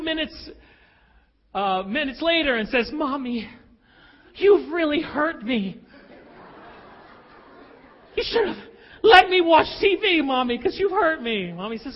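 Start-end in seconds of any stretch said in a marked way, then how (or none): 2.9-11.5 a daughter guilt-tripping her mom; wounded child. Retold or imitated.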